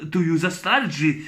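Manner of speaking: usual speaking voice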